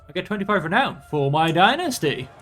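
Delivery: ordinary talk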